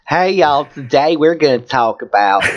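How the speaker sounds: hammed-up Southern host greeting; mock camp Southern voice; campy Southern accent.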